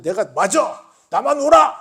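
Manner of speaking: loud, high emphatic outburst; self-righteous insistence. Acted. Preacher mimics someone claiming only they are right, shouted insistence.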